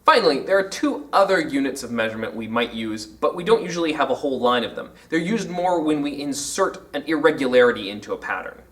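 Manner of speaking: ordinary talk, nothing marked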